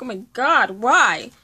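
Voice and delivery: loud, high cry of dismay; anguished outcry. Retold or imitated. Vlogger retelling her reaction to a sad scene, loud anguished cry. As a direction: cry out as if the book hurt you. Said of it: "And I was like".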